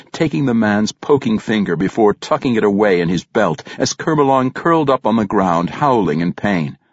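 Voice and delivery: usual speaking voice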